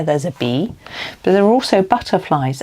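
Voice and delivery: ordinary talk, nothing marked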